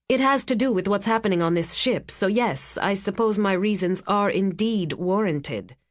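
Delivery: usual speaking voice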